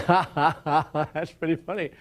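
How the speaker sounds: put-on ha-ha, carrying into the words; imitated laughter. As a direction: act out an amused crowd member laughing. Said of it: "Some folks were laughing".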